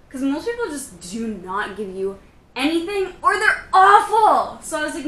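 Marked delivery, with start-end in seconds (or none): 3.2-4.6 AWFUL punched high and loud; emphatic. Real, not acted. Venting about customers to viewers, loud stress on "awful". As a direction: hit "awful" hard, stretched out.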